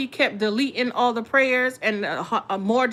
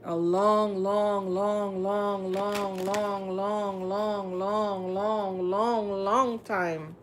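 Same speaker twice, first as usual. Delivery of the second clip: long, long, long chanted down to a murmur; stretched repetition; much slower than usual. Shown as a demonstration. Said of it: "Somebody could have been glamoring y'all for a long time".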